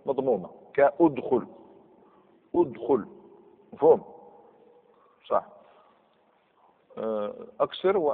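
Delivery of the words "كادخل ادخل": ادخل opening on "u": udkhul; damma on hamza. Shown as a demonstration. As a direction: start ادخل with a clear "u". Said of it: "ان كانت عين الكلمه". (ادخل بضم الهمزة)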